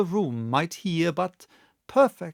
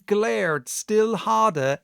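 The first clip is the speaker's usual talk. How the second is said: usual speaking voice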